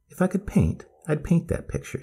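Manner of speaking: put-on high, eager old lady; grandma voice. A narrator's reading. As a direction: point it out like a delighted grandma. Grandmother muses.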